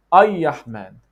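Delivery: 'Ay-Yahman' with a Y glide for the R, drawn out; R turned to Y. Shown as a demonstration. Teacher showing the funny fourth mistake, R as Y. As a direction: say 'Ay-Yahman', slowly, Y replacing the R. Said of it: "The fourth mistake, which is also funny one".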